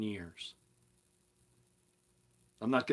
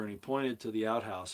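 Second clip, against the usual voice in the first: normal talking voice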